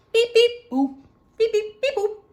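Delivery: high, loud robot beeping; robot beeps. Shown as a demonstration. Showing the child robot noises, high loud beeps. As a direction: beep like a toy robot. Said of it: "What noises do robots make?".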